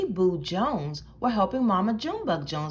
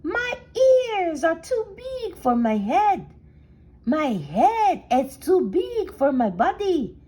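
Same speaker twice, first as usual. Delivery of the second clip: put-on proud kitten in a Spanish accent, higher and louder; 5 semitones higher, 4 dB louder than usual. A narrator's reading. Kitten posing as a Chihuahua in the mirror, Spanish accent. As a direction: boast it in a big Spanish accent. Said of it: "using his very best Spanish accent".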